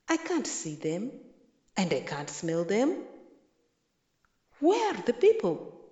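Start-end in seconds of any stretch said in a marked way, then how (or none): 4.6-5.8 higher, louder, dismayed cry. A narrator's reading. Kyundu alone in his empty village, crying out dismayed. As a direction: call out in disbelief. Kyundu finds no feast again.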